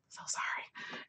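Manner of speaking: whispered apology. Real, not acted. Whispering an apology to the viewers for leaning back. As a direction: softly whisper sorry to the viewers.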